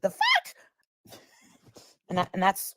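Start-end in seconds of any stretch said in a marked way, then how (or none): 0.0-0.5 sudden high-pitched disbelief; incredulous squeak. Retold or imitated. Tiffany reacting to the gun picture, mock high squeak. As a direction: squeak it out in shock, much higher. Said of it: "Tiffany's like".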